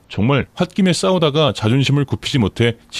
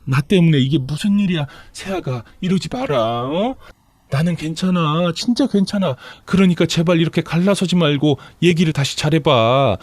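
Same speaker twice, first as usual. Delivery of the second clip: put-on high, imploring older relative's voice; distressed, coaxing plea in raised pitch; pleading mother-in-law; 7 semitones higher than usual. A narrator's reading. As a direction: beg the daughter-in-law to reconsider. Mother-in-law pleads against the divorce.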